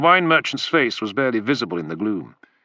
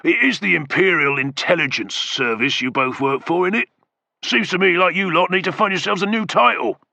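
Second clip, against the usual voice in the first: higher, cocky jibe; taunting; 8 semitones higher than usual. A narrator's reading. Macro jeering at the spy, cocky taunt. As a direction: needle him with a grin.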